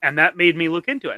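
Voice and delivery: usual speaking voice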